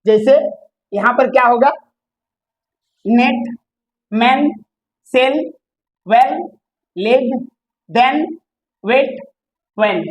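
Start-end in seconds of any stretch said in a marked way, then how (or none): none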